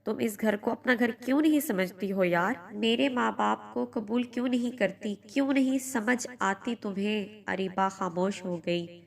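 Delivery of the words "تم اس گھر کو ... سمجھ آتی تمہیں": plain reading voice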